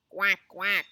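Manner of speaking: loud double quack; quacking duck. Acted. Duck answers Cow in a story, quacking. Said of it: "said Duck".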